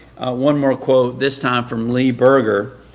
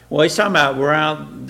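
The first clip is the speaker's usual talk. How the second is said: ordinary talk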